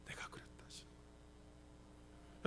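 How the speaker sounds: barely audible, voiceless whisper. Shown as a demonstration. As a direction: let it out as a very faint whisper. Repeats God's words hushed for effect. (아주 작게 속삭이듯)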